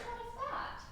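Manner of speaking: sharp high demand; impatient cry. Acted. Juliet impatient for answer.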